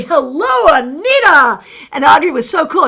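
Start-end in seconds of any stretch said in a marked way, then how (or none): 0.0-1.6 mock loud announcement; retelling a loud greeting with the wrong name. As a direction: call out a cheerful hello. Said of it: "I announced that very loudly".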